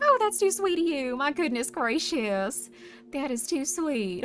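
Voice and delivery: Southern voice. Acted. Streamer thanking chat for a sweet compliment, Southern voice. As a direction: gush slowly in a Southern voice. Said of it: "That's a good Southern voice".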